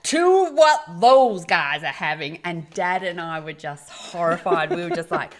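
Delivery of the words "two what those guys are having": put-on slow, loud, broad American accent; a hammed-up American ordering loudly in a Paris cafe; big American accent. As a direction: boom it out like a loud American tourist. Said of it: "in his big American accent".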